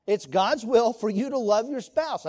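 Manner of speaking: usual speaking voice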